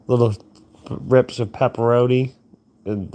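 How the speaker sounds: usual speaking voice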